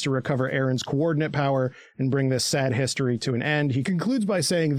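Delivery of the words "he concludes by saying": ordinary talk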